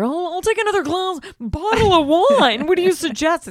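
pompous high voice ordering wine; mock rich guy. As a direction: play the snooty father. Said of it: "talking to the waiter".

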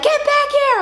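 high, loud mock yell; yelling after him. Mom chasing son in traffic, high mock yell. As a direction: call him back at full voice. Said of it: "I'm like".

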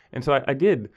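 ordinary talk, nothing marked

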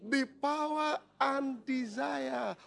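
slow, drawn-out emphatic scripture reading; preaching read. Real, not acted. Preacher reading the verse slowly to the congregation. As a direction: stretch each phrase for weight.

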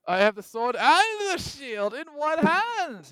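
booming high, drawn-out battle boast; hammed-up heroic yell, stretching the words; mock-heroic shout. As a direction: shout it slow and grandly over the top. Mock epic boast, clowning.

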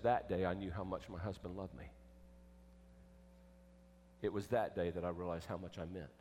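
low, hushed, heartfelt reflection; tender retelling. Retold or imitated. Wife recalling how much her husband loved her, tender and low. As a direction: drop low and soft, quietly moved.

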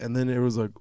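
ordinary talk, nothing marked

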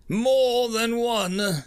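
slow, high stunned murmur; stunned commander repeating the news slowly; dazed. A narrator's reading. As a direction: let it hang in disbelief, slowly. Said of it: "thought Carmack dazedly".

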